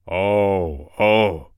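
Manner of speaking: loud growling bear; a big, loud growl; bear's growl. A narrator's reading. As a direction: growl it loudly as an angry bear caught a thief. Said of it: "he growled".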